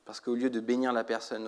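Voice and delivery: ordinary talk, nothing marked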